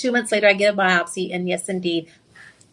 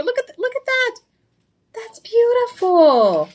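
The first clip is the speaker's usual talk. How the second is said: high, slow, awed gush; awed delight over the ring; admiring gush; 12 semitones higher than usual. Real, not acted. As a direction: marvel at the ring.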